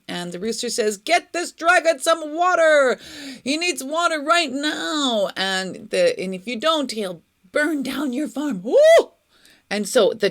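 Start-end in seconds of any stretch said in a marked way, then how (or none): 1.0-8.6 high, loud, urgent demand; demanding rooster. A narrator's reading. The rooster demands water for the thirsty dragon, urgent and loud. 8.6-9.1 very high, loud whoosh; imitated dragon fire. A narrator's reading. The dragon threatens to burn the farm, a loud fiery whoop.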